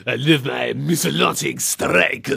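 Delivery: imitating the awkward villain line; game villain voice. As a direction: deliver it like the game.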